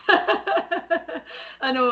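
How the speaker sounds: laughing through the words; words breaking into a laugh. Real, not acted. Laughing at accent comment.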